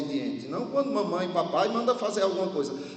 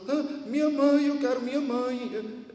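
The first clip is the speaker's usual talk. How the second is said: put-on mild sobbing child; 6 semitones higher than usual. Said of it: "soluçando".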